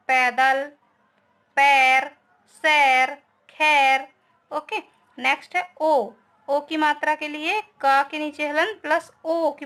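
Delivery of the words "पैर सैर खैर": पैर, सैर, खैर with the ऐ vowel held long and slow; stretched example words. Shown as a demonstration. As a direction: draw out each ऐ for the class. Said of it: "आई की मात्रा से ये उदाहरण". (ऐ की मात्रा को खींचकर धीरे बोलना)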